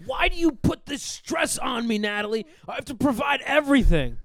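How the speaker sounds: high, loud, hammed-up outburst; overacted marital blow-up; angry husband shout. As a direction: yell at your wife in mock exasperation. Acted marital quarrel with fridge wife.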